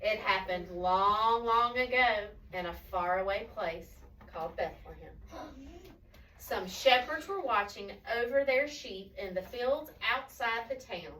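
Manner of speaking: slow, hushed-to-wondering storybook narration; story reading to children. A narrator's reading. Telling children of the first Christmas, slow storytelling. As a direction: draw out the words, pausing for suspense.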